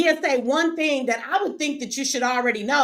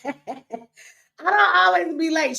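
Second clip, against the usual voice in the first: high, drawn-out protest through a laugh; laugh, then whine back in your defence; high-pitched, slow comic denial; 5 semitones higher, much slower than usual. Real, not acted. Protesting being called late, laughing.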